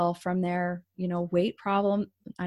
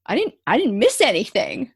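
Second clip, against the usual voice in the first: a bit louder, indignant protest; defensive attitude; 4 semitones higher, 7 dB louder than usual. Retold or imitated. Defending her drawing to the counselor, mildly indignant. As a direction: insist you missed nothing, mildly offended. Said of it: "This was my attitude".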